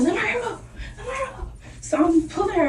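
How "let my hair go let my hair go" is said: high squeaky laughing plea to let go; pleading high laugh. Retold or imitated. Girl yanking her hair, she squeals high and laughing. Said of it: "I'm like".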